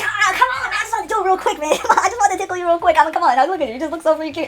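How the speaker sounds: high, coaxing mock plea; playful pleading. Player pleading to tickle Cal, high coaxing voice. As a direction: coax him like a cute pet.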